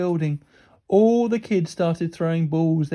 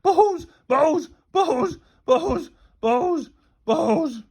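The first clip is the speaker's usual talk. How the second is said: high, loud crazed repetition; unhinged, obsessive raving; gone bananas; 10 semitones higher, 7 dB louder, much slower than usual. A narrator's reading. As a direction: babble it madly, easing down in pitch. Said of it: "as if he'd gone bananas".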